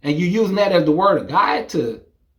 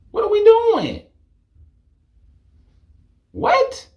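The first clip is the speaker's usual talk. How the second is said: very high disbelieving what; shrill stunned disbelief; incredulous; 3 dB louder than usual. Real, not acted. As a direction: squeak out total disbelief. Incredulous reaction.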